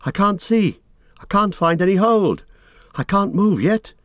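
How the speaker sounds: high, distressed calling; helpless call. A narrator's reading. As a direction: call up from a ledge, stuck and blinded. Frodo stranded, calling up to Sam.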